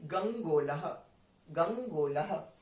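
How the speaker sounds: गंगोलह with the half "गंग" flowing straight into "गोलह", slow; careful teaching pronunciation. Shown as a demonstration. Teacher showing students how to join the letters in गंगोलह. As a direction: glide from गंग right into गोलह, unhurried. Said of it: "तुरंत हम दूसरा वाला word जो letter है उसको pronounce".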